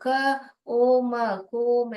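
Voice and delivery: क, ओ, म sounded apart, then कोम with long O; wrong blended reading. Shown as a demonstration. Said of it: "ऐसे नहीं पढ़ते हम". (क, ओ, म जोड़कर लंबे ओ वाला कोम)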